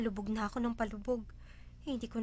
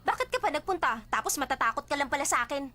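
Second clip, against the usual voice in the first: raised, loud scolding; angry; 4 semitones higher, 5 dB louder than usual. Acted. Confronting a visitor who got scared of them, angry. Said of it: "Bakit ka ba galit na galit?".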